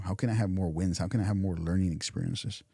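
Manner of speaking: ordinary delivery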